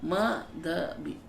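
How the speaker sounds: very slow माधवी, each syllable on its own; syllable-by-syllable name. Shown as a demonstration. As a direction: stretch माधवी as maa... dha... bee. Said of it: "धरती का धा और माँ की माँ".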